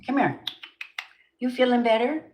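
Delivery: high, sing-song cooing. Real, not acted. Calling her cat over to comfort her, high baby talk. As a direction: coax the kitty over gently.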